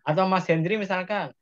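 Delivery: normal talking voice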